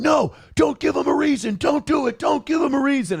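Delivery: high, urgent call to back off; urgent yelling. Retold or imitated. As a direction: shout to calm the crowd down. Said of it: "the protesters started yelling".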